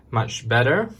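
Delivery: ordinary talk, nothing marked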